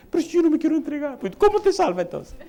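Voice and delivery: high, loud, laughing mock voice; imitating a stubborn old mother while chuckling; stubborn mother voiced.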